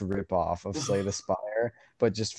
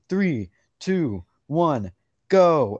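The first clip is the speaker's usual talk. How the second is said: countdown; 5 semitones higher, 6 dB louder, much slower than usual. Retold or imitated. Game countdown voiced.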